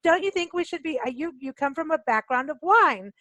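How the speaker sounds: normal talking voice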